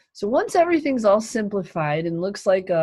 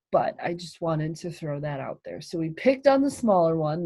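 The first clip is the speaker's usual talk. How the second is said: usual speaking voice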